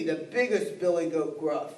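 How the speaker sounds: big goat. Acted. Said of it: "And he said".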